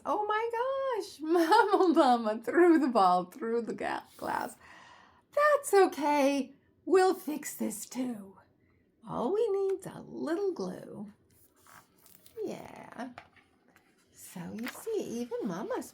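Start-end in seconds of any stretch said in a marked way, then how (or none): 0.1-4.5 laughing surprise. Real, not acted. Reader reacts to Mama breaking glass. 5.3-11.1 reassuring mama voice. Acted. Mama Llama reassures in the story.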